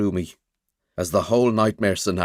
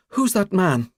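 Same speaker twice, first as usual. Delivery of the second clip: shaking voice; 11 semitones higher, slower than usual. A narrator's reading. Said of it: "Her voice is shaking with stress".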